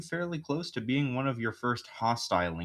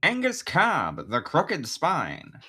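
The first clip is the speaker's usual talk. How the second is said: dramatic announcer; 5 semitones higher, 5 dB louder, much slower than usual. Acted. Announcing character name dramatically.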